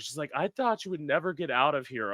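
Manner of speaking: ordinary delivery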